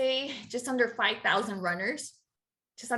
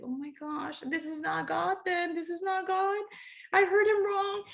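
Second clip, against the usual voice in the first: retold bummed; 7 semitones higher than usual. Runner told to cancel her race, mock dejected. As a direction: act out a let-down, pitch climbing plaintively. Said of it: "I was really bummed".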